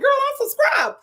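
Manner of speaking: high, teasing answer; sassy reply. Retold or imitated. [name] answering the shocked viewer, high teasing sass. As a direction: tease them, you're subscribed. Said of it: "I'm like".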